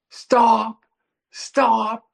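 high, urgent shout after a runaway animal; calling out. Retold or imitated. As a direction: holler to halt it. Calling after the fleeing raccoon.

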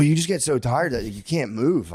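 ordinary talk, nothing marked

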